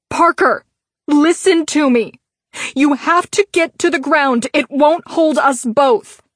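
loud, high, urgent yell. A narrator's reading. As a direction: yell at him over the flames. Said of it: "Millicent yelled at him".

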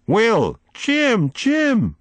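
very high, panicked screams of names; frantic cries. A narrator's reading. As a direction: scream for the boys as if going under. Drowning woman calls boys.

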